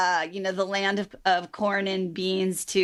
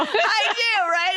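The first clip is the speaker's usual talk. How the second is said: squealing with real laughter, much higher and louder; laughing; 13 semitones higher, 4 dB louder, slower than usual. Real, not acted. Host laughing with her guest about her old boxer-shorts look. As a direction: burst out laughing through the words.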